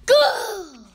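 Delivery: high, drawn-out shouted command; voice of God. A narrator's reading. God sends Moses off, thundering. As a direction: bellow the order at full force. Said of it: "said God".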